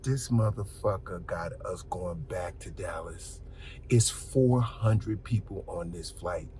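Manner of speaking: slow hushed whisper; slow whispered complaint on a full flight. Retold or imitated. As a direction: whisper it so nobody hears. Whispering to himself on plane.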